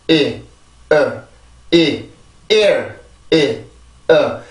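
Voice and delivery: isolated "이", "어" and "ear", each short and falling; syllable-building drill. Shown as a demonstration. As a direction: drill "이", "어", "ear" one at a time with gaps. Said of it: "자, 그 다음 앞에 거". (음절을 하나씩 끊어 "이", "어", "ear" 연습)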